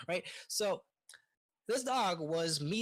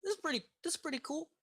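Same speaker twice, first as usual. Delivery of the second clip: put-on childish delight; 8 semitones higher, much faster than usual. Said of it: "We're like".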